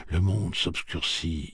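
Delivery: ordinary delivery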